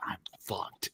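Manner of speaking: hushed, breathless "I'm fucked"; a kid whispering that he's doomed; whispered dread. Retold or imitated. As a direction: breathe it out in panic. Realizing dad saw him.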